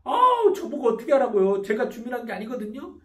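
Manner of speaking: mock petulant clerk, squealing at first; childish protest. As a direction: whine that it's not your fault. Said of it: "에프씨가 발달한 사람은".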